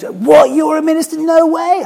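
high, incredulous imitated squeal; hammed-up shocked holidaymaker; mock astonishment. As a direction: act out his disbelief, way up high. Retells the man's shocked reply.

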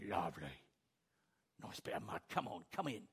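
low, soft pig voice luring; tempting pig. Acted. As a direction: coax them into the mud. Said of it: "The pig saying".

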